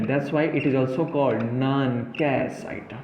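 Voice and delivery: crawling, weighty statement of a definition; very slow emphatic definition. Shown as a demonstration. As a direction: lay down the definition so it sticks. Teacher stresses key definition.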